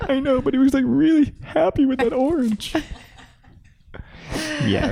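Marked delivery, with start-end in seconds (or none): 0.1-2.7 mock teary protest. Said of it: "I'm like".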